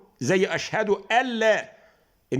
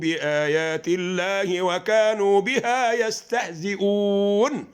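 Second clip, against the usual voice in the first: slow melodic tajweed chant, long held vowels; slow tajweed recitation of the verse; Quran recitation; much slower than usual. Shown as a demonstration. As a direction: recite the verse slowly, chanting each word out. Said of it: "تقول إيه؟".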